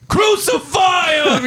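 high, drawn-out mock shout; mock mob cry. Speaker mimics punk-show people turning on someone, mock outcry. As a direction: cry it out like an accusing crowd. Acting out an accusing crowd.

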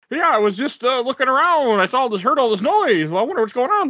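high feigned innocence; innocent neighbor act. Retold or imitated. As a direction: play dumb, all innocent.

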